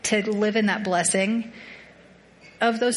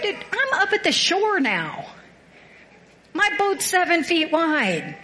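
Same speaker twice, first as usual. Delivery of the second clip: exasperated arguing; 6 semitones higher than usual. Retold or imitated. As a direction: argue back as fed-up [name]. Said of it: "I argued".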